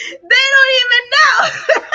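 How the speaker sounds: high, loud burst of laughter through words; laughing hard. Real, not acted. Speaker delighted by her own advice, laughing loudly. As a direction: crack up mid-sentence.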